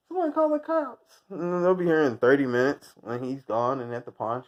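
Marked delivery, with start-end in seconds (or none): 0.1-1.2 much higher, hammed-up plea; mock victim. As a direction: play the helpless victim. Mock victim calling for help.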